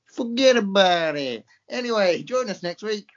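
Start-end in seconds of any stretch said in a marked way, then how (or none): 0.1-1.4 put-on slow, drawn-out New York accent. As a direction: lean into a mock New York tough guy. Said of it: "I can do New York now as well".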